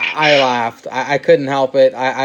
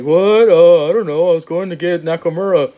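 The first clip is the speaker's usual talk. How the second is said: startled, higher and louder flustered excuse; mock-flustered wrestler excuse; caught-out Sami Zayn; 4 semitones higher, 4 dB louder than usual. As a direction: play Sami caught off guard, stammering. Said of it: "Sami was just like".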